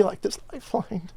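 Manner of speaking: high, cracking voice, welling up; fighting back tears on the last words; voice breaking. Real, not acted. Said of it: "I don't wanna get emotional about it".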